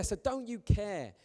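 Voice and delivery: usual speaking voice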